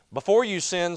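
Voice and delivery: normal talking voice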